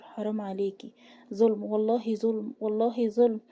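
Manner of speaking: usual speaking voice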